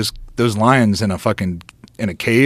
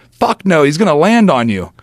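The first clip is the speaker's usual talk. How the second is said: higher, louder sharp refusal; alarmed warning shout; 4 semitones higher, 5 dB louder than usual. Retold or imitated. Friend warning him sharply.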